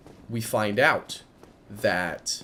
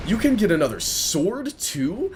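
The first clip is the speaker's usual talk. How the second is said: put-on higher, amazed kid question; excited kid; 7 semitones higher than usual. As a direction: gasp it out in wonder. Kids amazed at artwork.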